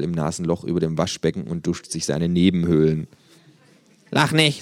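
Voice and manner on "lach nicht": understated don't-laugh complaint; subdued protest. Acted. Kangaroo with plugged nostril to flatmate, subdued protest. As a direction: say it as a small, resigned objection. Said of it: "sagt es".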